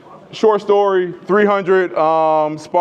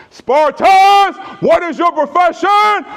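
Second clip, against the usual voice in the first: much higher, louder shouted war cry; battle cry; 13 semitones higher, 4 dB louder, slower than usual. Retold or imitated. Leonidas rallying his Spartans, a roaring war cry. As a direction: roar it like a king to his army. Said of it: "he says".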